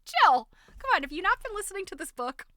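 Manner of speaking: high, loud playful reproach; mock scolding. Host chiding Jill for not listening, mock scolding. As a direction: call the friend out in pretend outrage.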